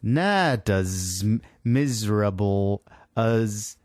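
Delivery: very slow, halting New York accent attempt; phonetic New York accent. Co-host struggles through Gerard Butler's line, New York accent. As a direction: sound out garbled New York spellings. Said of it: "make you sound like you're from New York".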